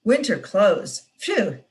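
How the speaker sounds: plain reading voice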